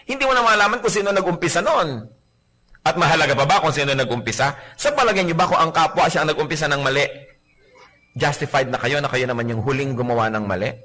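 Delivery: ordinary talk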